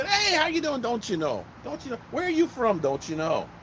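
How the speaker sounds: high, chatty mock Minnesotan; Minnesota accent. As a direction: imitate over-friendly Minnesota locals, dropping at each tag. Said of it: "people were so friendly".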